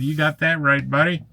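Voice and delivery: high, hearty buddy reply; high enthusiastic agreement; cheery reply. Retold or imitated. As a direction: answer your friend with gusto. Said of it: "And I responded".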